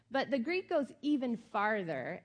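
ordinary talk, nothing marked

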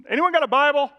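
high, loud shout to a crowd; calling out. Retold or imitated. Man asking townsfolk for a Bible, calling out. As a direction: holler it around, pitched well up.

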